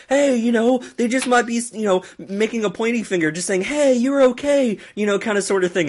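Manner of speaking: high, upbeat John Cena voice. Retold or imitated. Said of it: "John Cena saying".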